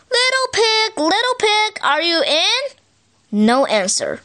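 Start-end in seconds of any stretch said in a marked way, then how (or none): 0.1-2.6 calling out. Retold or imitated. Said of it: "So I called".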